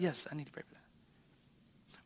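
soft whispered inner voice; hushed aside to yourself; whispered thought. Retold or imitated. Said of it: "you're like".

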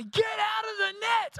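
high, slow exasperated yell; crowd shouting. Retold or imitated. Players shouting at the tangled goalie, high yell. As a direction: shout it at the kid stuck in the net. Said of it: "Everyone's shouting at me".

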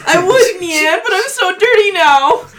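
high, laughing mock cry; high mock sob through laughs; laughing wail. Dirty cat wails laughing.

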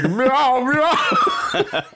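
very high, hammed-up mournful meow; sorrowful meowing. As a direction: wail it like a tragic clown cat. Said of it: "Pagliacci kind of sorrowful way".

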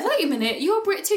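high, loud retold astonishment; surprised realisation. Guest retells realising Amy is a Brit too, surprised. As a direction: act out the penny dropping, pitch shooting up.

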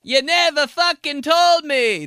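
loud, high, slow Italian complaint; Italian accent. Retold or imitated. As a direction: wail it Italian-style. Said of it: "start being all Italian".

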